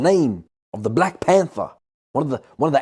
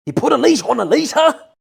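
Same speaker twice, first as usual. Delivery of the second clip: excited; 9 semitones higher, 6 dB louder than usual. Real, not acted. Excited repeat of lyric.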